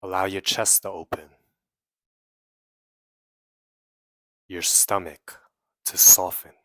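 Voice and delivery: measured meditation guide with long pauses; guided meditation. Real, not acted. Guiding listeners to release trapped emotions, in an unhurried meditation voice. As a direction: lead them inward phrase by phrase, leaving long silences.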